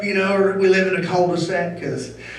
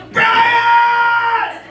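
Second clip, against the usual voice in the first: high mock yell of a wife calling from upstairs; shouting a name; 4 dB louder than usual.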